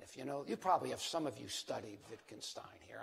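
ordinary delivery

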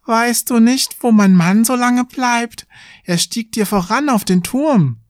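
high-pitched worried wife character; sexton's wife asking. A narrator's reading. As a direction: ask after your husband in a high, pressing voice. (hohe Stimme der fragenden Küstersfrau)

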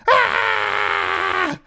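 high terrified scream; panicked scream. Retold or imitated. Kid fleeing spiders around the yard, screaming. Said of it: "ran around the yard going".

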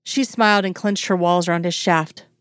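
ordinary delivery, nothing marked

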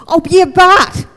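loud, high, eager burst; eager exclamation. Acted. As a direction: jump in bursting to share news. Paul eagerly answers friend.

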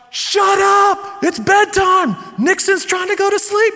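high, loud mock-exasperated shout; scolding yell. Wife telling noisy family it's bedtime, imitated yell. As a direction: imitate a fed-up parent yelling.

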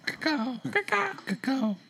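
high soft squeaking; squeaky mock voice. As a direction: squeak the word over and over. Repeating the word mockingly.

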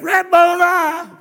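high, loud, drawn-out cry of recognition; joyful cry. Acted. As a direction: cry out the name in joyful shock. Mary recognizes the risen Christ.

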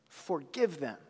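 hushed, merciful plea; soft plea. Acted. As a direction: barely voice it, pleading. Jesus on the cross.

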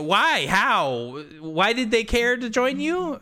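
rising, baffled questions, drawn out; pitching up in mock disbelief; mock bewilderment. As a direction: act baffled by the skipped scene. Mock bewildered player reaction.

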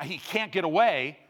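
normal talking voice

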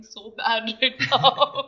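laughing through the words; giggling while admitting it. Real, not acted. Laughing at own fear.

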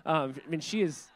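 ordinary talk, nothing marked

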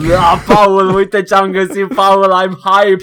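gushing, high and loud; hyped retelling. Retold or imitated. Telling a friend about a find, breathlessly hyped. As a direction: reenact your own overexcited hype. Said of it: "ți-am zis săptămâna asta cu, e, cu pre-ejaculat".

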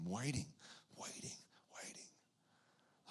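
trailing off into a whisper; fading to a whisper. A narrator's reading. Preacher fades Simeon's waiting to a whisper.

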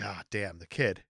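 low, soft muttering to oneself; muttered realization. Retold or imitated. Imitating the neighbor listening for the boy, quiet muttering. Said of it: "he's, like, listening for it".

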